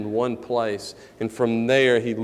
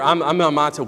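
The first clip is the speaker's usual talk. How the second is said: ordinary talk, nothing marked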